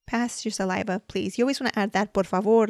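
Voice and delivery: ordinary delivery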